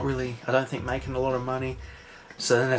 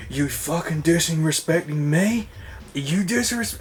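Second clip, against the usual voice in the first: slow, higher retold outburst, cut off; mock angry; 6 semitones higher, slower than usual. Richie grabbing David at the card game, mock angry. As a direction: snarl it out as a retold gangster.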